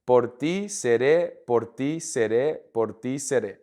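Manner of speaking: slow, measured practice repetitions; pronunciation demo. Shown as a demonstration. Spanish teacher drilling a phrase for students, slowly demonstrated. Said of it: "let's practice the pronunciation one more time".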